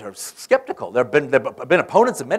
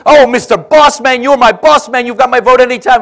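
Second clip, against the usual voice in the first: higher and louder, gushing with delight; jubilant; 9 semitones higher, 10 dB louder than usual. Retold or imitated. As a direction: gush over the boss like an overjoyed senator. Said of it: "Long is jubilant".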